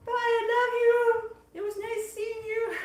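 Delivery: cute goodbye voice. Pretend. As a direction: coo goodbye like to a tiny friend. Saying goodbye to the Toasty S'more.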